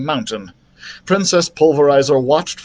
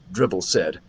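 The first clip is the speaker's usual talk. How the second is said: plain reading voice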